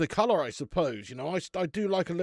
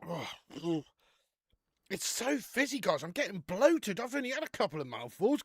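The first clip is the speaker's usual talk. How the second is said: normal talking voice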